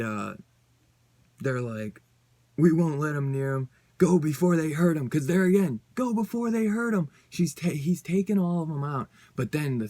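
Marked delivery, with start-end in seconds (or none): 2.6-5.1 louder, barked order to run; urgent shout. Acted. Teammates order Betsy to flee, shouting urgently. 5.9-7.1 much higher, near-shrieked warning; shrill urgent repeat. Acted. Narrator rereads the warning to flee, much higher.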